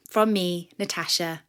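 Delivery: ordinary delivery, nothing marked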